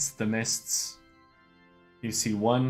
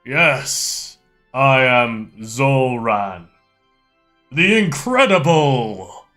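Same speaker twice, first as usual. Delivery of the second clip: loud, slow grandiose mystic; fortune teller; 4 semitones higher, 11 dB louder, slower than usual. Acted. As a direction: proclaim your own greatness, theatrical. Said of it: "Are you the fortune teller?".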